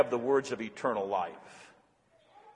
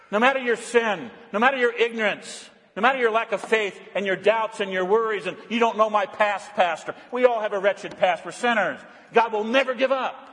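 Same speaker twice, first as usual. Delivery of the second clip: raised, ringing sermon voice; fervent preaching; 7 semitones higher, 3 dB louder than usual. Acted. Pastor preaching loudly to his flock, fervent. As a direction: preach it out loud to the congregation.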